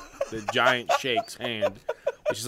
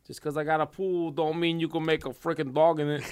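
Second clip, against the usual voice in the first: higher-pitched cartoon character accent; Carl; 6 semitones higher than usual. Podcaster trying out an Aqua Teen impression, in Carl's accent. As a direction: put on an imperfect cartoon neighbour accent. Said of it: "I can do the accent".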